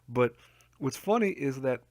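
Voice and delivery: usual speaking voice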